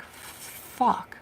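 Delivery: breathy whispered curse of panic; a scared whispered swear; panicked whisper. Retold or imitated. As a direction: hiss it under your breath in alarm. Panicked dream memory, whispered curse.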